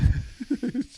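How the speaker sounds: high-pitched burst of giggles; high laugh. Real, not acted. Co-host tickled by the icebox joke, high laugh.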